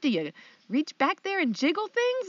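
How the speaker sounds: high, fast and desperate; retelling herself begging mom for advice, frantic; frantic plea. Retold or imitated. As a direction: rush it out like a panicked student. Pleading with mom, retold.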